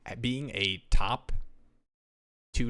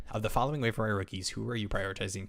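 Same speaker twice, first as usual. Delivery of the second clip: normal talking voice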